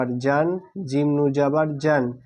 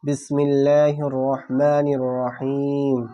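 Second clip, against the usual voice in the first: slow, drawn-out Quranic chant of the opening phrases; formal Arabic recitation; much slower than usual. Acted. Teacher opens the Quran lesson with slow chanted invocations. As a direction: intone each phrase slowly and reverently.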